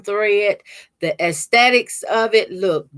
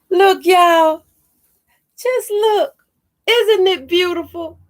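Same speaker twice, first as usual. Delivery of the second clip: thrilled showing off; 8 semitones higher, 3 dB louder than usual. Real, not acted. Showing off the gift card.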